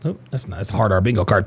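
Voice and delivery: ordinary talk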